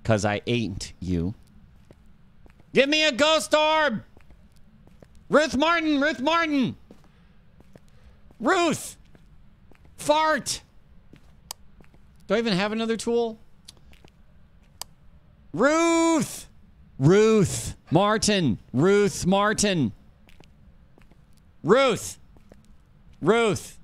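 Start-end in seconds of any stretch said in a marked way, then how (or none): 2.7-10.6 calling out. Real, not acted. Calling out to the ghost. 15.5-23.8 high, drawn-out loud calls; hollering the name into the dark; calling out. Real, not acted. Summoning the ghost Ruth by name, loud calls.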